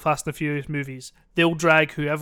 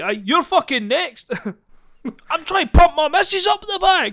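much higher, louder menacing yell; threatening shout; 9 semitones higher, 5 dB louder than usual. Acted. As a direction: warn the next one off. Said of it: "quite a good wee skit".

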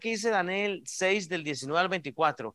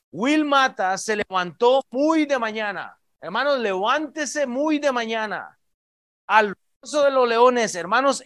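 loud, high, urgent preaching to the congregation; rally the flock, pressing every phrase; fervent preaching; 4 semitones higher, 4 dB louder than usual. Acted. Preaching at full force. (predicación ferviente y en voz alta)

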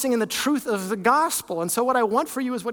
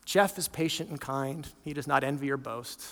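quiet demo reading; 7 semitones lower, 8 dB quieter than usual. Shown as a demonstration. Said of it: "insert your name".

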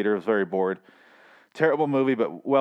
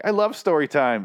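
put-on childlike followers; 6 semitones higher, 3 dB louder than usual. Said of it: "doing like little kids".

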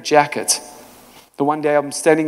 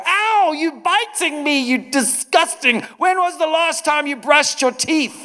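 high mock-disgusted squeal, much higher pitch; mock disgusted whine; 12 semitones higher than usual. Preacher acts out the wrong reaction to a biting boy, high and disgusted. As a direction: whine like a grossed-out caretaker. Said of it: "Did I respond like that?".

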